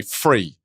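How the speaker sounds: "Free" with an F sound for three. Shown as a demonstration. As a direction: say "Free" where you mean three. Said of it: "I would say".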